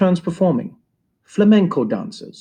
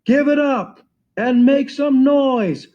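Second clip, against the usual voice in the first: hype man shout; 10 semitones higher, slower than usual. Retold or imitated. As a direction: work the crowd from the stage. Hyping crowd before the dance.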